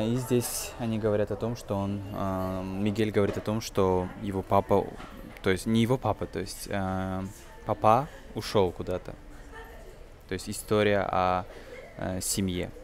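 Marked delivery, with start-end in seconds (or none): none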